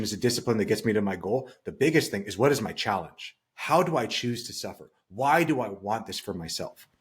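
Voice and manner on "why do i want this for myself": usual speaking voice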